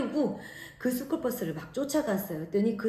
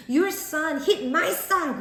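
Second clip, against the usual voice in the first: high-pitched, heated accusation; retold angry outburst; 7 semitones higher, 4 dB louder, much slower than usual. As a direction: accuse the other parent, voice raised high. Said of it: "너무 화가 나서".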